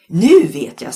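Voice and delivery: bright sudden idea, loud; cheerful dad with a plan. A narrator's reading. Dad in the car announcing a plan, cheerfully. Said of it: "sa Lennart muntert".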